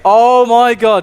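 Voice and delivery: stunned outcry. Real, not acted. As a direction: draw it out in real shock. Caster reacts to a frag.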